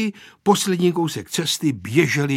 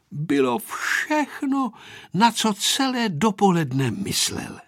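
ordinary delivery, nothing marked

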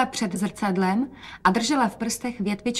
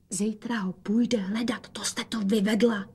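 whisper; 4 dB quieter than usual. A narrator's reading. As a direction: whisper the reproach secretly. Šeptá matce. (vyčítavý šepot)

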